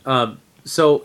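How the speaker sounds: ordinary talk, nothing marked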